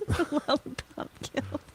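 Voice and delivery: munchkin voice. Pretend. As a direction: squeak it like a munchkin. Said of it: "Lollipop Guild munchkin quote".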